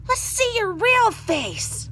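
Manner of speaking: put-on high, loud pestering kid; visiting children tugging her nose. A narrator's reading. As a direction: tease like a bold child. Children teasing witch.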